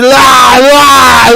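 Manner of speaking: loud playful call for the bottle; mock shout. Student shouting to his friends for the bottle, mock loud.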